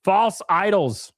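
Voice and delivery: false idols drawn out slowly; slow dramatic catchphrase. Acted. Streamer repeating his slow mock-solemn catchphrase to chat. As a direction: intone it slowly like a verdict.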